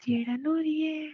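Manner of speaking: teasing. Acted. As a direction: needle him with stretched-out words. Wife teasing annoyed husband.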